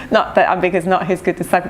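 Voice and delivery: normal talking voice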